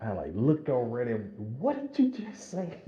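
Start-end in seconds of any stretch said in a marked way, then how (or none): 1.6-2.8 high, incredulous exclamation; a startled what-did-you-say, pitched way up; stunned disbelief. Retold or imitated. Runner turns to his partner in shock, high disbelief. Stunned reply to odd remark.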